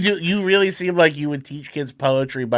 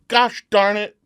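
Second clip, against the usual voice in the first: mock mad; 8 semitones higher than usual. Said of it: "When you got mad".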